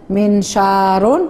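منشار stretched slowly, syllable by syllable; slow model reading. Shown as a demonstration. Teacher reviewing with the class, very slowly. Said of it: "النون بالسكون".

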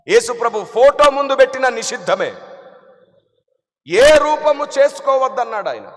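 loud, high emphatic sermon; forceful preaching. Acted. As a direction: hammer the point home.

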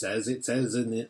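ordinary delivery

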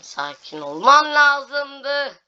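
high, loud, slow drawn-out whine; exasperated wail. Real, not acted. Gamer wailing at the game after another failure. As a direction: stretch the complaint out in despair.

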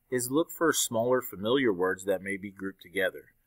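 ordinary talk

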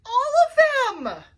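high, loud wail; desperate cry. A narrator's reading. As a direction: wail it out in despair. Desperate Coco Dodo on the phone.

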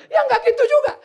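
high, loud protest; upset wife protesting. Acted. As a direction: snap back at the pastor. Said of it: "Satu orang telepon saya". (istri membantah keras)

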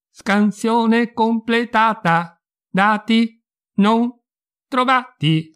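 slow, high, robotic announcement; cold machine reading out a result; emotionless robot. Acted. The house AI answers again.

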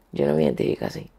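sinking low echo of "Yo no me identifico así"; quiet repeat. Real, not acted.

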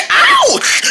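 high loud yelp; pained yelp. Acted. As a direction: yelp in pain. Hit, cries out.

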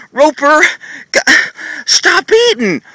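high, pleading nag; nagging. Shown as a demonstration. Trainer shows viewers bad nagging on Roper, high whiny plea. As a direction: whine at the horse to stop eating. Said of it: "I'm gonna show you what nagging and what most people do".

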